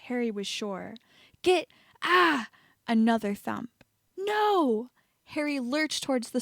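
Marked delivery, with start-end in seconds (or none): 1.4-2.5 high shout breaking into a cry; cut-off cry. A narrator's reading. Meyer struck by a curse mid-warning, crying out. 4.2-4.9 much higher shout of alarm; anguished cry. A narrator's reading. Harry seeing Meyer fall, anguished cry.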